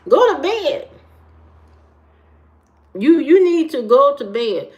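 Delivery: high, sudden mock-scolding order. Telling a sleepless viewer off, mock scolding. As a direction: order them off like a fussing parent.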